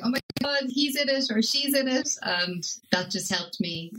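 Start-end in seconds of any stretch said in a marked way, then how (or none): 0.0-2.1 excited. Retold or imitated. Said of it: "I was texting you going".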